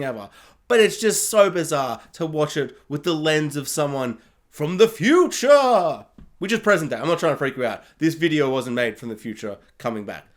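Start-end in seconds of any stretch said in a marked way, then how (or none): 4.5-6.1 slow, loud announcement of wonder; higher, louder, hammed-up mystery; mock-dramatic reveal. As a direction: draw it out like a sci-fi reveal. Mock spooky reveal about time.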